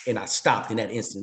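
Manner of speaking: ordinary talk, nothing marked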